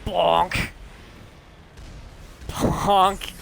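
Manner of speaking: gleeful high bonk sound effect; playful bonk. Pretend. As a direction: shout bonk like a cartoon hit. Bonking enemies in game.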